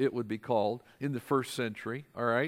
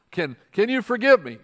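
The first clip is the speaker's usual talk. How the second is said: pleading; 6 semitones higher, 8 dB louder than usual. Pretend. Preacher acts out plea for forgiveness.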